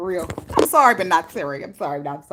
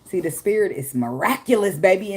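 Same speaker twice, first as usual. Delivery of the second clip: ordinary talk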